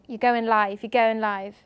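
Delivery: producer cue. Retold or imitated. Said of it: "when they go".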